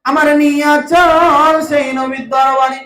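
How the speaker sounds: high, loud chant with very long vowels; full-force melodic preaching. Acted. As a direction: cry out the story in soaring waz melody. (উঁচু গলায় সুরেলা ওয়াজ)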